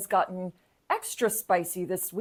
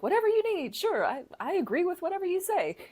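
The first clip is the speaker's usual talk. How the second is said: mock simpering yes-man; 12 semitones higher, faster than usual. Imitating Graham obeying Trump.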